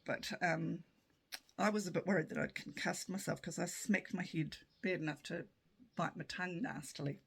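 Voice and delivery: ordinary talk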